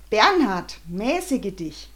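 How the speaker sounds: loud, then slow rebuke. Acted. Mother rebukes her husband, loud and slow. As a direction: call him to order, slowly. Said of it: "krächzten wie zwei Raubvögel".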